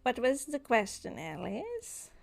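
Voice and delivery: odd creature voice with drawn-out, swooping "yes"; Nanoxus. Acted. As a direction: stretch the final words in a creepy lilt. Game master plays Nanoxus.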